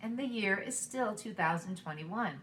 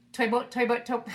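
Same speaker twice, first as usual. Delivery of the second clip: really fast; 4 semitones higher, 7 dB louder than usual. Shown as a demonstration. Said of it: "Let's go really fast".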